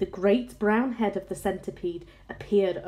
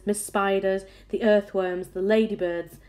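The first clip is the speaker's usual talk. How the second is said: ordinary delivery, nothing marked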